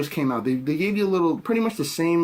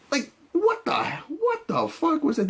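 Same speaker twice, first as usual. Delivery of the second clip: much higher, disbelieving; can't believe what he just saw; incredulous; 13 semitones higher than usual. Real, not acted. Incredulous reaction to the scene.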